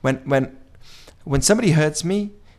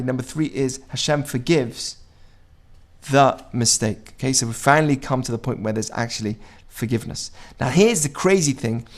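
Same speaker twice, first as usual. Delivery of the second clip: ordinary talk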